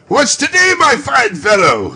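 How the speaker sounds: put-on eager call to a boy below. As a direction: shout down to the street. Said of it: "said Scrooge".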